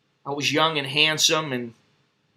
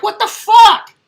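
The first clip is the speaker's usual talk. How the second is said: shocked outburst; 10 dB louder, slower than usual. Retold or imitated. Shocked at crying girl at door.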